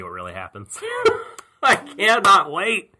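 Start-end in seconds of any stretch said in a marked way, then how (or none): 1.6-2.9 high, loud, drawn-out eagerness; emphatic excitement. Real, not acted. Podcaster anticipating the reveal, gleefully emphatic. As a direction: stretch each word with giddy anticipation. Excited about the coming story.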